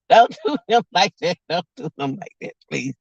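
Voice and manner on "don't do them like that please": much softer and quicker, trailing into please. Retold or imitated. Begging the family to stop joning, soft quick plea. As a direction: drop it away to a hushed quick begging. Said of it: "I be like".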